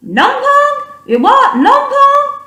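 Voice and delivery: very high, laughing squeaky "numpong"; high movie voice. Retold or imitated. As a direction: squeak it out in disbelief. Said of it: "what movie that's from".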